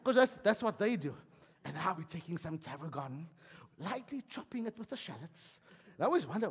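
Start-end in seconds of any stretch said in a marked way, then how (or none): 1.6-5.6 hushed cooking-show commentary, much softer; TV chef whisper. Acted. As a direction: murmur it like a TV chef narrating the recipe. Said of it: "I even talk when I make the food and I whisper".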